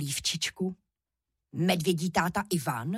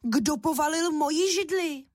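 higher-pitched mama bear outcry; raised, high storybook bear voice; mother bear's voice; 8 semitones higher than usual. A narrator's reading. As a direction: cry out as an indignant mother bear. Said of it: "rozkřičela hlasem medvědí maminky".

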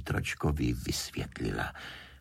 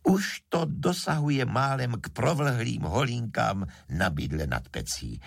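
ordinary delivery, nothing marked

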